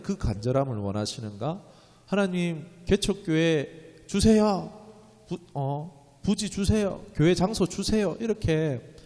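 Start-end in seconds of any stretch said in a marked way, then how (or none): 2.1-8.2 slow, higher mock plea for a church; drawn-out pleading to God; earnest begging prayer. Pastor mimics his own prayer for a church site, slow. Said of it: "간절하게 기도하기를 원하시나".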